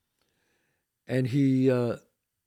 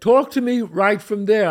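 loud, higher command to stay back; yelled; 5 semitones higher, 6 dB louder than usual. Retold or imitated. Homeowner shouting at the stranger to stay back, loud yell. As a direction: yell it to stop him coming closer. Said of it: "I yelled it".

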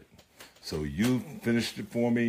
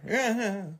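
laugh. Real, not acted. Laugh after jingle.

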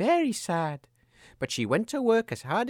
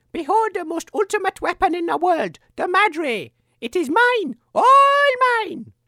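higher and louder, rising to a shriek; proclaiming evil queen; 11 semitones higher, 6 dB louder than usual. Acted. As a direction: gloat over the weapon, building to a scream. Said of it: "she proclaimed".

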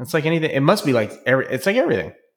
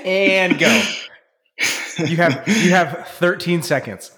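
mock moderator; 4 semitones higher, slower than usual. Playing debate moderator.

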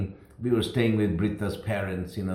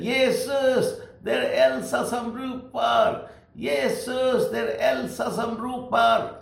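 shouting in Swedish; 12 semitones higher, slower than usual. Retold or imitated. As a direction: cry out at the top of her voice. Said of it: "she was shouting".

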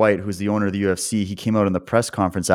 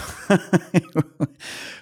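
high rueful laugh; 9 semitones higher than usual. Real, not acted. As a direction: laugh so you don't cry. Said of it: "I have to laugh".

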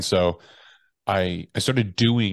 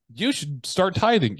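hammed-up mentor barking tasks; mock bossy mentor; 11 semitones higher, slower than usual. Mentor pushing him to say yes, mock bossy voice.

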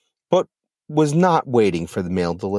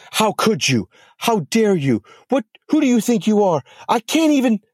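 much higher, shrieking rage; screaming; 12 semitones higher than usual. Acted. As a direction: scream at Medusa down the phone. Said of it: "Lilith screamed".

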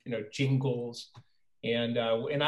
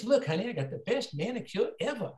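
1 woman's voice; 8 semitones higher than usual. Retold or imitated. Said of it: "she goes".

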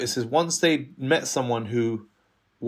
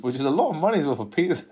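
normal talking voice

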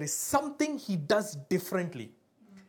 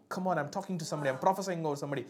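normal talking voice

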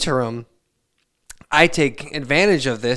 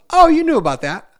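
much higher, louder breezy wife voice; caught-out wife; 14 semitones higher, 8 dB louder than usual. Retold or imitated. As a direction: play the wife surprised he knew. Voicing his wife caught out.

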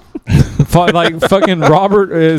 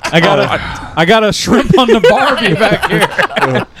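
mock Australian accent; 4 semitones higher, slower than usual. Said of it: "trying to speak Australian".

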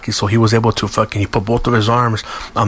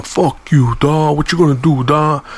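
raised-pitch, goading delivery; mock taunting challenge; 5 semitones higher, slower than usual. Harry daring the mechanic to fight, imitated taunting. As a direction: goad him like you want a fight, re-enacted. Acted taunt in a retold quarrel.

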